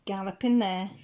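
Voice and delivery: ordinary delivery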